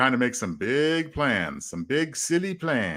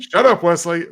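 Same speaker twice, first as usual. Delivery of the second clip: mock snap; 4 semitones higher, 8 dB louder than usual. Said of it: "make that".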